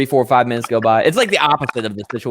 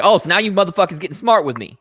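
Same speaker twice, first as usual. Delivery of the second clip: mock indignant customer; 6 semitones higher than usual. Customer accusing staff.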